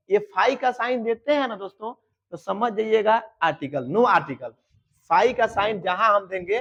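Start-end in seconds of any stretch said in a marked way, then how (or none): none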